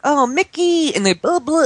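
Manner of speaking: put-on high, cooing small-child voice; little child imitation. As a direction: babble like a sweet toddler. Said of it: "then she'd be like".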